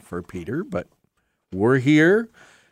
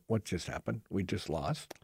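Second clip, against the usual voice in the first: stunned murmur; 3 semitones lower, 15 dB quieter than usual. Retold or imitated. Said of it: "we all just stared out and went".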